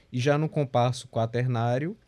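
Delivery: normal talking voice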